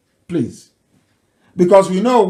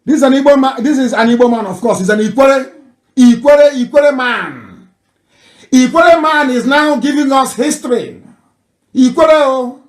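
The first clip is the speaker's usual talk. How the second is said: louder and higher, hammering the point home; excited insistence; 6 semitones higher, 5 dB louder than usual. Real, not acted. Commentator telling listeners the speaker is Ikwere, loud and excited. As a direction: exclaim it to the crowd like a revelation.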